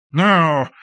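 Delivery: sudden high, loud cry; high excitement. A narrator's reading. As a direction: burst out eagerly. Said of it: "in high excitement".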